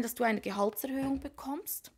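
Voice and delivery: ordinary delivery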